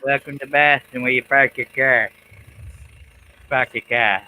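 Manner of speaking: lightly put-on Boston accent. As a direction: try a Boston accent, dropping the r's. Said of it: "Let's hear your best Boston accent".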